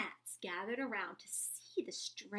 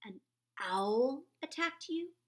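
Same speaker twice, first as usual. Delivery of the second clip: slow, louder worried mother; anxious mother bat; 4 semitones higher, 8 dB louder, slower than usual. A narrator's reading. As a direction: ask it slowly, worried.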